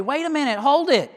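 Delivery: father protests as staff grab his son, alarmed. Retold or imitated. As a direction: stop them grabbing your boy. Father stops them taking son.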